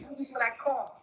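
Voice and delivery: put-on high, playful New York accent on coffee, said as kwaw-fee; mock New York accent. Co-host echoes him in a teasing New York accent. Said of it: "I do say coffee".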